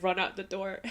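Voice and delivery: normal talking voice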